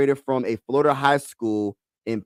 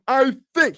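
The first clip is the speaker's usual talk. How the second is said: "I think" pitched way up for emphasis; Joel Embiid voice with emphasis; 12 semitones higher, 3 dB louder than usual. Acted. Star player imitated for fans, "I think" high and emphatic. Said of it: "I just put some more emphasis in it".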